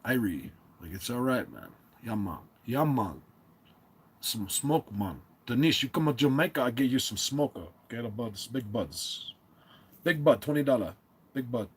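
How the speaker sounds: mock Jamaican accent, lightly put on; a playful fake Jamaican accent, loose and laid-back; bad Jamaican accent. Vlogger hamming a Jamaican dealer to viewer Denise, mock Jamaican accent. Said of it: "That's not a Jamaican accent".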